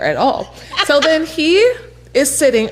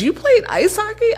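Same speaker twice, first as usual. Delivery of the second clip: much higher, astonished question; surprised lady; 13 semitones higher than usual. Retold or imitated. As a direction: act out her disbelief, pitched way up.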